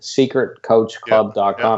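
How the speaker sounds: slow, deliberate, each word separate; very slowly. Shown as a demonstration. Host to audience, repeating the address very slowly. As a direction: say it slowly so nobody mishears. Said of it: "I have to say that out very slowly".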